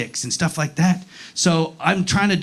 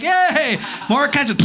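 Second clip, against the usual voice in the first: higher, sing-song celebration; hammed-up happy yay; mock cheer; 7 semitones higher, slower than usual. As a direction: cheer like waiters bringing prizes. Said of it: "come back out like".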